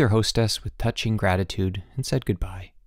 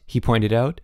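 usual speaking voice

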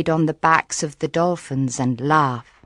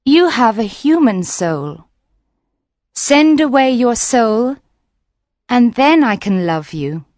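lightly voiced mermaid, a bit higher; 5 semitones higher, 3 dB louder than usual. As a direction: gently set the condition. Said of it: "the little mermaid said".

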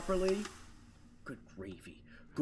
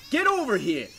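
higher, louder shout; loud call; 8 semitones higher, 7 dB louder than usual. Real, not acted. Player shouts at the object they need. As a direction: shout it across the room.

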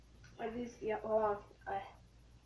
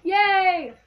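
excited cheer; 6 semitones higher, 7 dB louder than usual. Real, not acted. Celebrating a good flavor.

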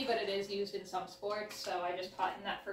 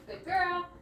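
high praise; 8 semitones higher, 4 dB louder than usual. Real, not acted. Praising Mally after turn.

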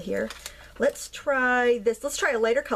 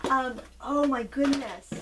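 ordinary talk, nothing marked

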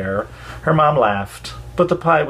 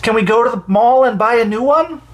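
high, louder eager plea; eager pleading child; 10 semitones higher, 4 dB louder than usual. A narrator's reading. Emily begs her moms for a new ball, eager plea. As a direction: beg like a kid who won't give up.

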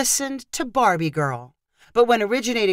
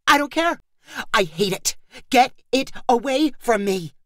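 frantic refusal; 4 semitones higher than usual. A narrator's reading. Megan refuses the toy, frantic.

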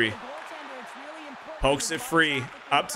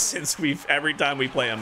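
ordinary talk, nothing marked

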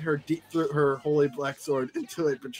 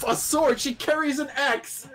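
much higher, outraged cry of disbelief; indignant disbelief; 11 semitones higher than usual. Real, not acted. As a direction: viewer protesting the fanfic's wrong weapon, indignant. Outraged at the fanfic.

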